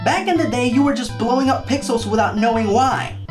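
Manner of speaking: normal talking voice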